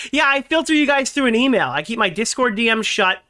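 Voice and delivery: normal talking voice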